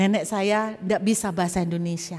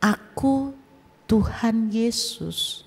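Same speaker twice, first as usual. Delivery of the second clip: slow, measured, solemn divine voice; voice of God; much slower than usual. Retold or imitated. Jesus introducing himself to the youth, slow solemn voice. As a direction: let each word fall slowly like a voice from heaven. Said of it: "Suara itu berlanjut".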